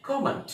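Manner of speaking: "कमेंट" with a British o, "ko-ment"; British pronunciation. Shown as a demonstration. Teacher showing learners British English, British pronunciation. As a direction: say "कमेंट" the British way, rounded first vowel. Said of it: "British English में बोलते हैं तो".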